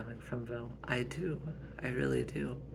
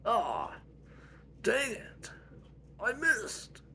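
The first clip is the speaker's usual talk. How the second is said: put-on much higher voice for Patrick, a kid groaning; Patrick's voice; 11 semitones higher, much slower than usual. Patrick complaining after getting knocked down, high voice. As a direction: squeak it out as the little brother who lost.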